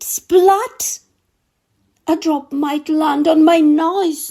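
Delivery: high, frightened cook voice; scared cook. Acted. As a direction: squeak out the cook's fear, pitched high.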